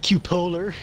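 "Cupolar" said kyoo-POH-ler, loud and rising; mangled pronunciation. Retold or imitated. Mocking both sides' versions, "Cupolar" as kyoo-POH-ler. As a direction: blend "cue" with a stressed "POH-ler" in "Cupolar".

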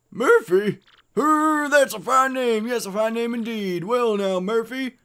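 high, excitable mayor voice; gushing village mayor. Acted. Mayor mishearing Mario's name, gushing. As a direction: gush over the misheard name.

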